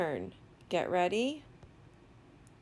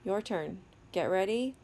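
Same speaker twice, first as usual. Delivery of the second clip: ordinary talk, nothing marked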